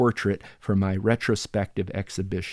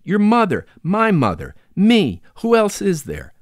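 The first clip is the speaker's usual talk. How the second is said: emphatic protest; 8 semitones higher, 6 dB louder than usual. Acted.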